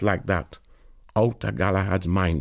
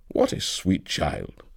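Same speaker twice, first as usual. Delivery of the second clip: lower, slow put-on posh English accent; 4 semitones lower, slower than usual. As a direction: lay on the English accent, slowly and sweetly. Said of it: "putting on the old English accent".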